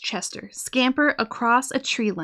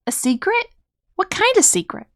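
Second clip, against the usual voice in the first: higher, eager questions; curious child; 8 semitones higher than usual. Acted. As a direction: perk up with curiosity, much higher. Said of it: "looked interested".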